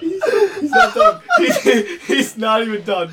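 high, squeaky through tears of laughter; after laughing hard. Real, not acted. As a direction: choke the words out between laughs. Laughing too hard to speak.

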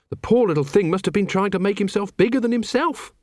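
high-pitched earnest subordinate; high character voice; Carrot. A narrator's reading. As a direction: lift the pitch for an innocent young guard. Carrot reasons aloud in story.

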